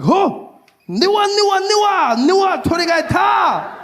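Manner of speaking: high squeaky niwa niwa; a hammed-up high Japanese exclamation; excited mock Japanese. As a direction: yelp it out like pointing at chickens. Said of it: "I was like".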